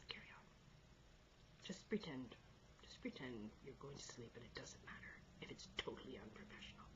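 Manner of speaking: real soft whisper; whispered aside. Real, not acted. Reader to listeners pretending to sleep, whispered. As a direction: whisper reassurance to a drowsy listener.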